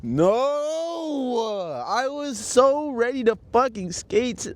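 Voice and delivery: high, drawn-out wail of protest; anguished dismay. Real, not acted. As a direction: wail it in real frustration. Dismayed at losing the skate session.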